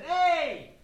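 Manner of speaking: loud, high, drawn-out "hey"; calling out. Acted. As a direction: hail someone you suddenly recognise. Calling out the knight he recognises.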